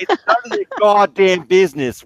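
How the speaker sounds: put-on gruff Boston driver accent. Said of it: "my best Boston accent".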